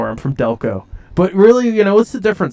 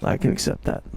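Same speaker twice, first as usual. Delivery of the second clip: whispered concession; 8 dB quieter than usual. Acted. Character grudgingly concedes.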